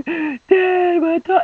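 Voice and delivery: high, loud, tearful wail; crying to dad. Retold or imitated. Crying daughter calls her dad, high tearful wail. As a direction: sob it to your father. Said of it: "I was crying".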